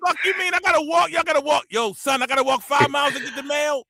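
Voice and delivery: put-on high, indignant New York accent; high New York accent outraged; outraged New Yorker. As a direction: complain like it's unthinkable. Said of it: "They be like".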